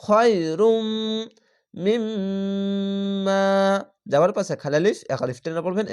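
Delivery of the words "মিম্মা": মিম্মা with a long nasal hum on the doubled ম, very slow and soft; slow nasalized recitation; ghunna. Shown as a demonstration. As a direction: hold the গুন্না in মিম্মা. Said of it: "এখানে ওয়াজিব গুন্না".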